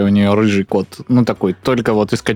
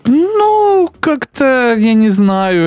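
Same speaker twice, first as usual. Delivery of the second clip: flustered high voice; 12 semitones higher, much slower than usual. Real, not acted. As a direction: pitch jumps way up. Flustered at the question. (смущённо, высоким голосом)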